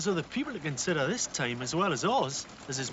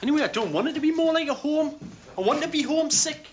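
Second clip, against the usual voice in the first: higher and louder, insisting hard; loud protest; 11 semitones higher, 3 dB louder than usual. Acted. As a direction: blurt it out in stubborn protest. Insisting against the redecoration plan.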